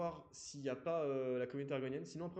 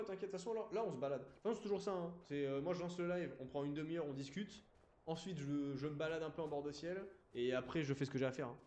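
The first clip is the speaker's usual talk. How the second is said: normal talking voice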